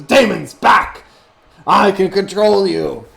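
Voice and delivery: loud, high command to the demons; commanding shout. Acted. Wizard ordering demons back, loud and high. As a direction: bark it like he's in charge. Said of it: "he looks at you and says".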